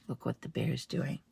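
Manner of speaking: plain reading voice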